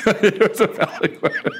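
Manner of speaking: real laughter through the words; laughing. Real, not acted. Host agreeing with his guest's joke, laughing as he talks.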